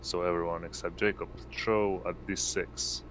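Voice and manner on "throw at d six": ordinary delivery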